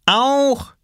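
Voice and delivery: put-on high little-kid "me too"; child calling out. Little brother wants to mix medicine too, high call. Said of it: "rief Lars".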